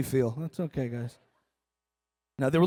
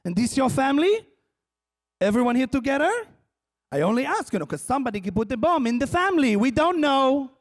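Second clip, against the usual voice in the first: put-on higher, accented suspicious questioning; Israeli interrogator; 6 semitones higher than usual. El Al screener doubting the family, suspicious. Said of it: "with that interrogation".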